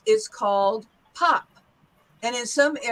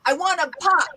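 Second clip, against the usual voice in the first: higher-pitched New York accent; 4 semitones higher than usual. Shown as a demonstration. Teacher models for students, New York accent. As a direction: punch out "pop" like a New Yorker. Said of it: "let's try this in a New York accent".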